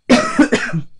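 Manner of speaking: sharp loud cough, then a quick pardon; coughing fit. Real, not acted. Crafter coughs mid-explanation, loud real cough. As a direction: cough hard into the mic.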